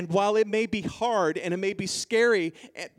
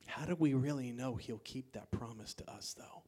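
very soft, low, near whisper; hushed question; 5 semitones lower, 12 dB quieter than usual. Real, not acted. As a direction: ask it under your breath, confidingly. Preacher drops to confiding doubt.

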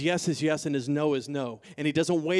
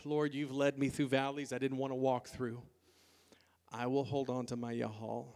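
hushed prayer; 6 semitones lower, 11 dB quieter than usual. Acted. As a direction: pray it low and intimately, almost to yourself. Voices David's prayer, hushed.

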